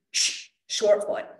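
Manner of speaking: slow hissed shh then short foot; hissed cue. Shown as a demonstration. Coach demos landing, hissed exhale cue. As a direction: hiss then cue slowly. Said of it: "I'll start by kind of doing this".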